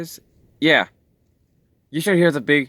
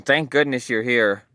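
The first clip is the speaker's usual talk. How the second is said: usual speaking voice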